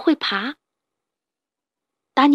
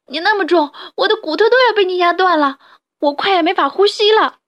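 crying out; 7 semitones higher, 6 dB louder than usual. Acted. The crushed rabbit wails at the badger, crying. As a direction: cry out under the weight. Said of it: "哈布斯哭着说".

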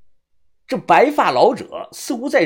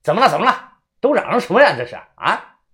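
raised, demanding voice; shouting; 5 semitones higher, 5 dB louder than usual. Acted. Ward manager rushing in at the noise, shouting. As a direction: burst in demanding an explanation. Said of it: "他进来便喊".

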